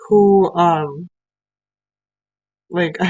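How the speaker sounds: normal talking voice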